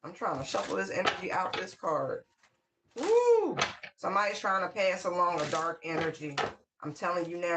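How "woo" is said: high, loud woo; excited whoop. Real, not acted. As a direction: whoop at what the card shows. Reacting to a drawn card.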